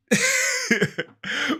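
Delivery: squealing laugh; high-pitched laugh. Real, not acted.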